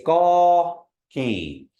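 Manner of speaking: gaw keen slow, pitch dropping on keen; falling second vowel. Shown as a demonstration. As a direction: let keen fall away slowly. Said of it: "tone goes down on the second vowel".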